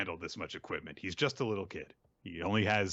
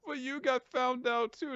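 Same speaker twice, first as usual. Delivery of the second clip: very high, squeaky mock retort; lackey's acted protest; whiny underling. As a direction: talk back in a hammed-up high whine. Scolded underling talks back.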